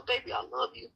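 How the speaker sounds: lightly voiced sweet comfort to a wife; tender reassurance. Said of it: "Tell her".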